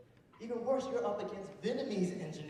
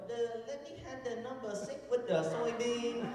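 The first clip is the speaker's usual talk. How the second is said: high, halting mock Vietnamese accent; friend's Vietnamese accent; 6 semitones higher than usual. Friend ordering at sandwich shop, Vietnamese accent. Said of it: "why are you speaking like that".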